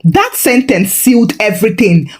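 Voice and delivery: ordinary delivery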